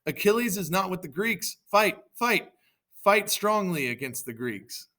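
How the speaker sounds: rally the troops with a light mock shout; mild acted shout. Hector urges the Trojans to fight, mild high shout. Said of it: "And then Hector shouted".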